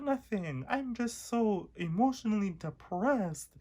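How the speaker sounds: high, slow hammed-up sad voice; imitating a high, slow sob voice; mock depressed whine. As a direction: play the tragic teen, overdone and slow. Mock crying answer to teacher.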